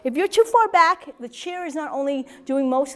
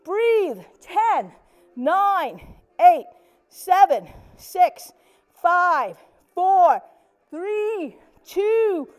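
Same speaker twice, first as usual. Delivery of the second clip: raised, evenly spaced count; effortful countdown; 3 semitones higher, much slower than usual. Shown as a demonstration. Instructor counting down from ten during arm press, effortful countdown. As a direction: count down steadily while pressing hard. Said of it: "as I count down to ten".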